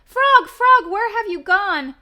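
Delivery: very high, loud frightened calls; panicked calling. Acted. Toad calling for Frog in the dark, panicked. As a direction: call out in panic for a lost friend.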